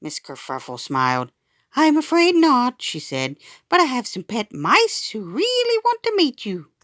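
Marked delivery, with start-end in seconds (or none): 1.7-6.7 gentle teacher. Acted. Teacher gently coaxing child.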